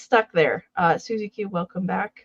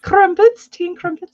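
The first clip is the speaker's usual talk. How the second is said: mock British accent; 9 semitones higher, 7 dB louder than usual. Playing along with the British accent.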